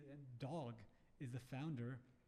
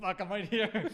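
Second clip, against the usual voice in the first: loud, higher, through laughter; laughing exclamation; 6 semitones higher, 13 dB louder, slower than usual. Acted. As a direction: burst out laughing as you call back. Jokes he is the owner.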